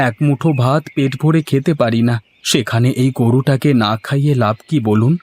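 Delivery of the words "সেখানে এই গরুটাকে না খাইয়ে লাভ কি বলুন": ordinary talk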